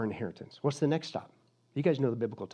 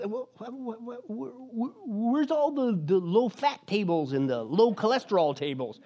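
a little timid; 7 semitones higher than usual. Acted. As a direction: stammer nervously before daring to ask. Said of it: "he, a little timidly".

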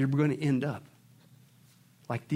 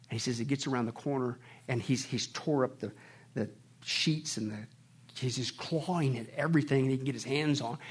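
normal talking voice

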